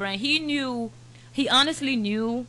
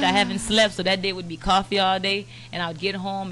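ordinary talk

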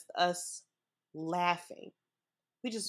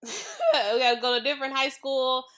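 starts with a laugh, louder and higher; 5 semitones higher, 5 dB louder than usual. Real, not acted. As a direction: laugh at the slip and say it again. Restart with a laugh.

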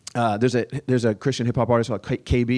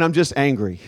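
usual speaking voice

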